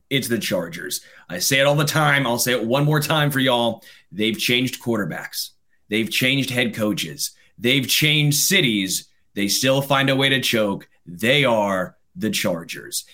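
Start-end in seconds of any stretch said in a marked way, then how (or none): 4.1-13.1 slow, weighty list; slow, damning verdict on a rival; deliberate recital. Real, not acted. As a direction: spell it out slowly, each item landing. Deliberate repeated list condemning a rival.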